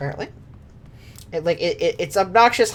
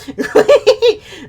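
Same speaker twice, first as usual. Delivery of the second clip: villain cackle; 7 dB louder than usual. Acted. Villain laugh.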